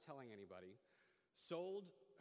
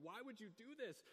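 much higher, incredulous why; exasperated outburst; 9 semitones higher than usual. Retold or imitated. Lying in bed, his hackles rise at the friend's choice, incredulous. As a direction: ask it as if you can't believe her. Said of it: "the hackles on my neck are just going".

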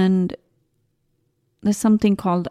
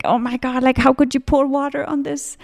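retold dismay; 6 semitones higher than usual. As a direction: imitate being aghast, voice held high. Retells shock at soaked book.

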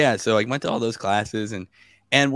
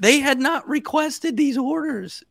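pitched way up in disbelief, slow; incredulous; slower than usual. Real, not acted. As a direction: stretch it out, still can't believe it. Incredulous about missing orders.

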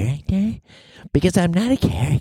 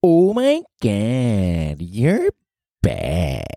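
put-on lower, cooing baby talk; playful lower baby-talk voice; 7 semitones lower than usual. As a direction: coo it like talking to a baby. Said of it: "shifting into this baby talk thing".

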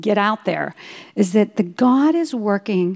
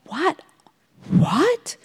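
stunned whisper; 9 semitones higher than usual. Retold or imitated. Shocked whispered reaction.

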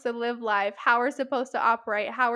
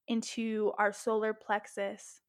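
ordinary talk, nothing marked

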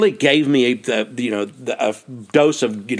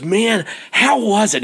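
retold eager question, pitched up; excited; 8 semitones higher than usual. Newcomer asks finishing hiker about the trail, excited. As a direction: ask it high, like an eager newcomer. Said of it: "He is so excited".

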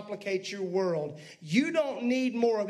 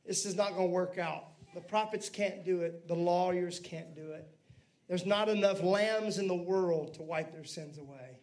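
lower, softer voice of God deciding; preacher voicing God resolving to send his son, low and soft; 6 semitones lower, 4 dB quieter than usual. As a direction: speak as God, low and quiet. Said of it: "finally he says".

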